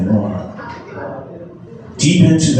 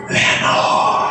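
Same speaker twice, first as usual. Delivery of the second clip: whispered echo. A narrator's reading. The echo answering the narrator's call, whispered. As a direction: let the name drift back unvoiced from the dark. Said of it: "an echo murmured back the word".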